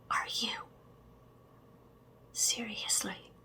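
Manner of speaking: ordinary talk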